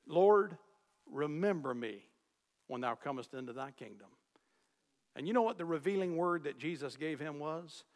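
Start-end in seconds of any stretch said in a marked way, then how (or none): 0.1-4.1 humble plea. Retold or imitated. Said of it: "he said".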